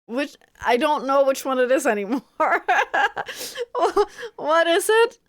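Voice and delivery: high, quick, laughing bafflement; laughing confusion. Real, not acted. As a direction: crack up while admitting confusion.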